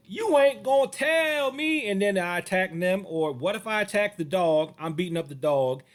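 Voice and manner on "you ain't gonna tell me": defiant. Pretend. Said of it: "where I was like".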